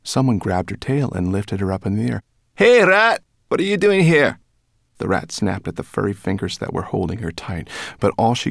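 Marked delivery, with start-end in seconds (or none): 2.6-4.4 high, loud cheeky creature voice; brownie calling out. A narrator's reading. Brownie catches rat by the tail, loud challenge. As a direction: challenge the intruder in a high yell.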